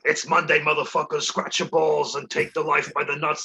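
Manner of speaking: lightly voiced brash comic impression; mock motivational speech, slowing at the end; Joey Diaz. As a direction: give a crude pep talk like a comic. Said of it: "Joey has the funniest".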